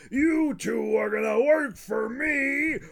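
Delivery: Mr. Krabs impression. Fan doing Mr. Krabs threatening the boys, hammed-up. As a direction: bellow it like a furious crab. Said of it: "my Mister Krabs impression".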